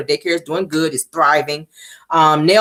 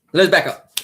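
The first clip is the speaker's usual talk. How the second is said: ordinary delivery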